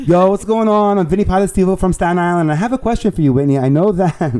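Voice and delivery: high, loud, hammed-up Staten Island accent. Introducing himself to a star, in a put-on Staten Island accent. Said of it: "I'll do it with my accent".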